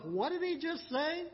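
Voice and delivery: stunned disbelief. Retold or imitated. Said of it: "they must have thought to themselves".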